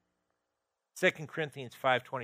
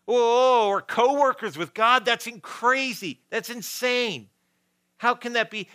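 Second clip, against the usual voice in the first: high, loud "oh", then slower astonishment; amazed disbelief; 9 semitones higher, 7 dB louder than usual. Real, not acted. Preacher stunned to be God's co-worker, amazed disbelief. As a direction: marvel at it, pitched well up.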